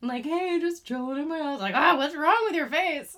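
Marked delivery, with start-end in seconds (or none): 0.2-1.6 higher, bright casual greeting; pitched-up mock chill hello; playful FaceTime voice. Acting out a FaceTime call. 1.7-3.2 higher, louder, laughing through it; laughing startled question; laughing mock alarm. Acted reaction to face mask.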